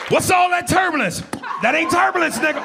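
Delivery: very high-pitched passenger cry; hammed-up airborne complaint; alarmed passenger. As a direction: call out in a high, worried voice. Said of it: "other passengers be talking about".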